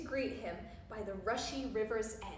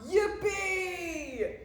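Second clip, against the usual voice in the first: high, loud, long whoop; storybook cry of glee; cried-out cheer; 6 semitones higher, 6 dB louder than usual. A narrator's reading. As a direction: whoop it out in triumph. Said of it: "cried out".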